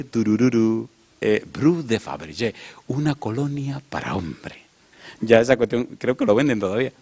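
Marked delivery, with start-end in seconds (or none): 0.0-4.6 put-on old ad jingle. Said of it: "había un anuncio que decía".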